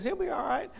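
high-pitched mom brushing off the worry; high, easy motherly dismissal; unworried mother. Retold or imitated. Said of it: "And she goes".

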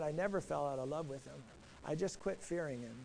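low, soft admission; subdued confession. Retold or imitated. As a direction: confess it quietly, voice dropped low. Retold confession from jail.

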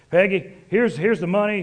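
booming and proud, handing over cash; hearty loud greeting. Retold or imitated. A burly neighbor bursting in to pay Peggy, booming. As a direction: call it out big, like a generous neighbor.

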